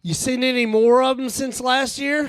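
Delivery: high tourist voice, earnest question; eager tourist. Retold or imitated. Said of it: "He's like".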